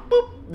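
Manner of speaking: tiny high-pitched boop; imitated door boop. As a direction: pop out a squeaky little door sound effect. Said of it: "it was just like".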